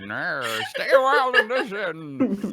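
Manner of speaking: hammed-up wise old storyteller, giggling; Deckard Cain voice. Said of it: "I'm Deckard Cain".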